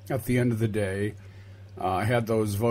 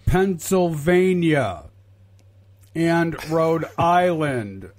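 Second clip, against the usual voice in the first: spelled out slowly; 3 semitones higher, slower than usual. Shown as a demonstration. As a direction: spell it out for slow listeners, syllable by syllable. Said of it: "the Trump supporters that may be listening".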